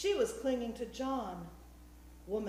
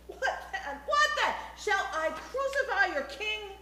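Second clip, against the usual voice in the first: very high, loud repeated questions; high-pitched outburst; shrieking; 9 semitones higher, 5 dB louder than usual. A narrator's reading. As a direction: shriek it at the crowd, climbing in pitch. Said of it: "shrieked Pontius Pilate".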